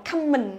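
"common" as "cammin" with an A vowel; learner's "common", first vowel like "come"; learner mistake. Retold or imitated. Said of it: "Từ này rất nhiều đọc là".

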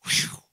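sudden loud blown "whew"; imitated blowing. Preacher showing how people fall out, imitated blow. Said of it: "And then he goes".

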